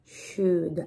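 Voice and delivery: quiet, careful "Should" without any L; perfect pronunciation. Shown as a demonstration. Teacher confirming the finished word, perfect pronunciation. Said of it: "Esa es la pronunciación perfecta".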